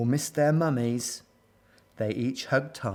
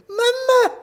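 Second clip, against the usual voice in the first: very high, loud cry for mum; shrill little child's distress call; 7 dB louder than usual. A narrator's reading. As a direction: squeal like a panicking baby gorilla. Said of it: "cried Abu".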